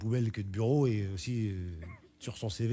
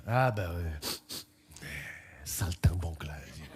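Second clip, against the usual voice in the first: low slow drawl; 9 semitones lower, 4 dB quieter, much slower than usual. Acted.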